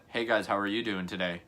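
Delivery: ordinary talk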